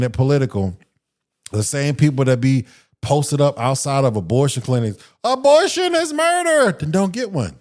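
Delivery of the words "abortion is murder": high-pitched imitation of a protester; mock protester. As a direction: cry it out high like a picketer, mockingly. Mocks clinic protesters' chant.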